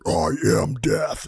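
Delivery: low, slow doom voice; grim reaper voice. Pretend. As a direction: intone it like death itself. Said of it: "that was a Gutfeld".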